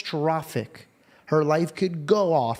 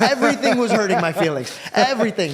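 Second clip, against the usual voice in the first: laughing; 6 semitones higher, 5 dB louder than usual. Real, not acted. Laughing at his own sensitivity.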